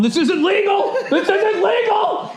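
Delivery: very high, strained imitated cry; hammed-up wail, stretching out the repeat; mock desperate protest. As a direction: mimic a man being dragged off. Retold man being dragged away protesting.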